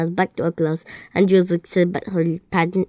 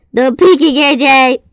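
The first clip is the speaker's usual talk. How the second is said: loud call, pitched up; teacher calling out; 6 semitones higher, 9 dB louder than usual. A narrator's reading. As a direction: call the kid's name across the class.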